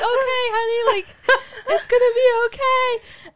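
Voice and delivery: high, loud, tearful comforting; mock soothing dad. Father comforting sobbing daughter, hammed-up high voice. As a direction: imitate dad consoling her, hammed-up and slow. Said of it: "He's like".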